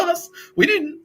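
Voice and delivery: high, loud flustered denial; flustered brand. Retold or imitated. Heinz Baked Beans denying it to the host, high and flustered. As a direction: squeak out a panicked excuse. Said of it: "They're like".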